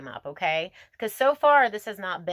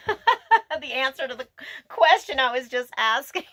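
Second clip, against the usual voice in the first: talking through laughter, higher and louder; laughing; 7 semitones higher, 7 dB louder than usual. Real, not acted. Reader amused that the card answers her question, laughing. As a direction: let the giggles carry the words up.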